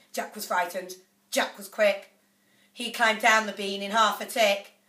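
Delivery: plain reading voice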